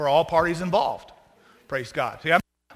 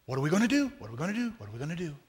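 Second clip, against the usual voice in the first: fading worried mutter; 2 semitones lower, 7 dB quieter, much faster than usual. Pretend. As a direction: worry fading ever softer. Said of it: "common conversation".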